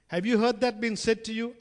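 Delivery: ordinary delivery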